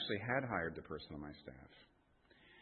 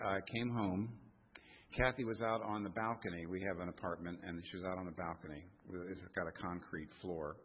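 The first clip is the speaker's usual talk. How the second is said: ordinary talk, nothing marked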